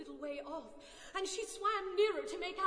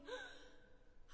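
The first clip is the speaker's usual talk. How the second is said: sharp breathy gasp; startled gasp; 11 dB quieter than usual. A narrator's reading. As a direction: gasp in surprise. Surprised at the gloves.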